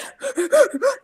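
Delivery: a high laughing half-sob gasp; imitating a choked-up gasp while laughing. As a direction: gasp like you're crying but laughing through it. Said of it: "crying hysterically".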